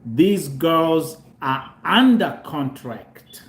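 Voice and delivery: slow, deliberate over-enunciation; said slowly. Real, not acted. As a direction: spell it out word by word, slowly. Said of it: "let me say this slowly".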